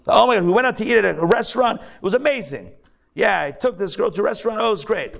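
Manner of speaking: high, fast mock rave; mock gushing. Rabbi mimicking a man raving about dinner, high fast gush. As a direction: rush it like a gushing braggart.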